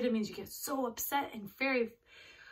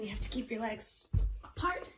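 normal talking voice

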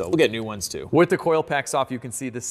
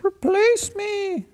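seal voice; 3 dB louder, much slower than usual. Pretend. Voicing the old seal.

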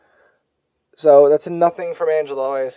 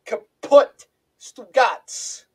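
put-on Brooklyn accent; 8 semitones higher, much slower than usual. Joking Brooklyn slang.

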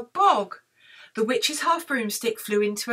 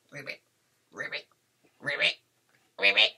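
frog ribbits; 11 semitones lower, faster than usual. Acted. Storyteller imitates the frog, low ribbits. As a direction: croak like a frog, pausing between each.

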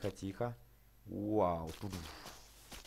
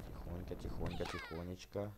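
much softer "тихонько, тихонечко", almost breathed; hushed coaxing; 4 semitones lower, 9 dB quieter than usual. Real, not acted. Guiding his dragon carefully, softly coaxing. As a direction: coax the dragon gently along.

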